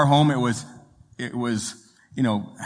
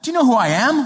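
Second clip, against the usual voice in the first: indignant; 10 semitones higher, 4 dB louder than usual. Retold or imitated. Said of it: "she said to the usher".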